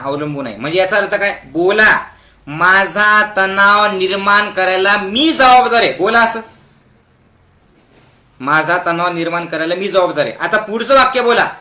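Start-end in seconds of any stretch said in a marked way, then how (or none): 2.5-5.9 deliberate dictation. Shown as a demonstration. Models line for crowd to repeat. 8.4-10.3 brisk, lower-pitched repeat; quick recap. Shown as a demonstration. Runs through line again with crowd.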